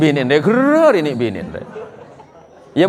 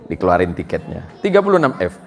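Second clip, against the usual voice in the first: usual speaking voice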